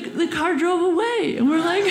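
usual speaking voice

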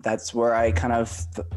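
usual speaking voice

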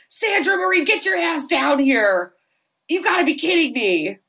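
high-pitched mock scolding, dragged out; exasperated parent. Parents summon their daughter downstairs, mock-exasperated.

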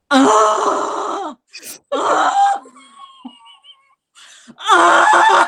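high strained zombie groans. Retold or imitated. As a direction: groan like a child playing zombie. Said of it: "she proceeded to make this sound".

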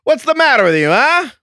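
loud, high mock confrontation; hammed-up vintage tough talk; old-movie line. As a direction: demand it like an old film star. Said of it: "bring some old lines back too".

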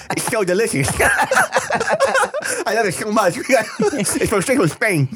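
high, laughing mock Spain accent with a lisp; hammed-up lisping Spanish accent; bad Spanish accent. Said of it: "I know how to do it perfectly".

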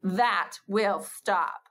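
very slow, firm and final; great mother voice. Shown as a demonstration. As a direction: plant each word like a staff in the ground. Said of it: "your great mother voice".

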